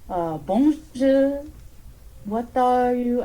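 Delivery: put-on slow, slipping French accent; a short-lived French accent, slow. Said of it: "I tried to do a French accent".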